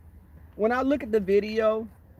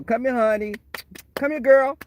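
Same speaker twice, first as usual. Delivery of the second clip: coaxing call, rising higher and louder; calling a dog; 6 semitones higher, 3 dB louder than usual. Retold or imitated. Replaying her call to the pig, coaxing it like a dog. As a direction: sweet-talk like calling a dog in. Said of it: "Like I was calling a dog".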